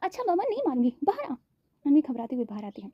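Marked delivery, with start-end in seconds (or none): 0.0-1.3 pitched up, irritated coaxing. A narrator's reading. Mother coaxing Anvi out, irritated and high. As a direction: coax her out through irritation. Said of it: "Nita जी चिढ़ते हुए".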